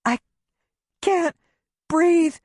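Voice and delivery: high, strained gasp for air; gasping panic. A narrator's reading. Hyperventilating wife gasping to her husband, panicked. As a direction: force the words out through panic. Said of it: "I try to tell him".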